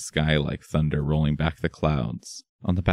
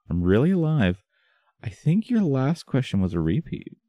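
teasing; 9 semitones higher than usual. Acted. Teasing a stunned friend.

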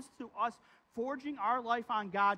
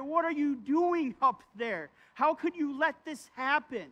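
higher, demanding, slowed accusation; outraged crying out; 5 semitones higher, 4 dB louder than usual. Retold or imitated. Preacher voicing David's anger and fear at God. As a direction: hurl the questions up at heaven. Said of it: "He is almost screaming at God".

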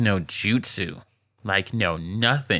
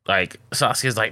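usual speaking voice

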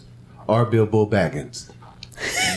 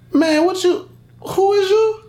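confused outrage; slower than usual. Retold or imitated. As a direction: shriek "who is you?" in disbelief. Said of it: "And then I'm like".

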